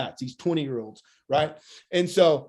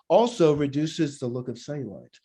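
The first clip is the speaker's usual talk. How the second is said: casual sales aside; 3 semitones lower than usual. Shown as a demonstration. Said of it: "You say it like that".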